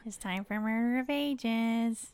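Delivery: slow squeaky helium voice; chipmunk voice. Real, not acted. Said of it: "You look like a chipmunk, too".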